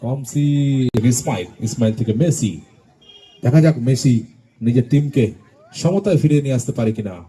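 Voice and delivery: loud rapid play-by-play; frantic football commentary. Real, not acted. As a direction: call the play as it happens. Live play-by-play. (উত্তেজিত football ধারাভাষ্য)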